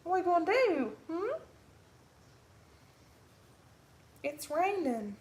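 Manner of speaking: a high, sing-song coo to the dog. Real, not acted. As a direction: coo the question up high like to a puppy. High baby talk to the dog.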